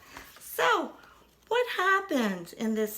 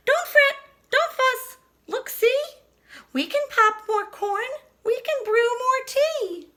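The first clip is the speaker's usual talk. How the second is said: much higher, louder squeaky mouse; squeaking mouse; 12 semitones higher, 5 dB louder than usual. Acted. Mouse comforting the bear, high and squeaky. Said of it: "Mouse squeaks".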